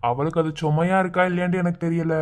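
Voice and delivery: usual speaking voice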